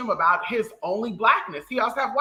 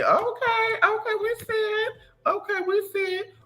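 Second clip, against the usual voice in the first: mock hype. High hype cheer.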